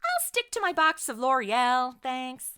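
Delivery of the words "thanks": ordinary talk, nothing marked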